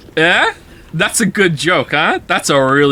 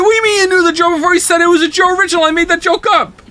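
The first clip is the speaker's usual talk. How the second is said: high, fast squeaky mock outrage; hammed-up high-pitched indignation; mock outraged chatter; 13 semitones higher, 5 dB louder, faster than usual. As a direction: play an indignant chatter in a shrill falsetto. Mock outraged chat reader.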